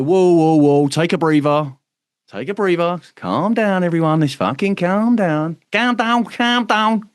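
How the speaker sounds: hammed-up "calm down" to the group; calming them down. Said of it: "And then we'll go".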